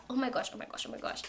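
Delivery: soft, repeated under-the-breath panic; panicked muttering. Retold or imitated. Student walking to bus stop, panicked muttering.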